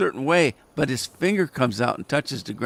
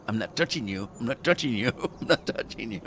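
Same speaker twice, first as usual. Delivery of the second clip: teasing quiet taunt; 2 semitones lower, 5 dB quieter than usual. Retold or imitated. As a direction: needle him softly, sing-song. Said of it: "and I s".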